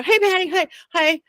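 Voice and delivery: high-pitched imitated hello; lifted, sing-out hello; crew's greeting. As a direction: mimic an eager group greeting. Crew greeting her, acted out.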